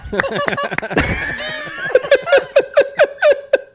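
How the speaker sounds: loud high burst of laughter; big loud cackling laugh; cracking up. Real, not acted. Cracks up at horse impression.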